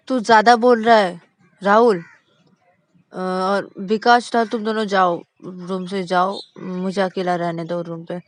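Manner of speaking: loud, firm command; angry order. Acted. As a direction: throw them out of the room. Shruti orders brothers out. (गुस्से में आदेश देना)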